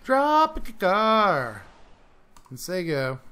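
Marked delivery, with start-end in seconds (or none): 0.0-1.6 higher, louder drawn-out call; announcer call. Acted. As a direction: hype it like a ring announcer.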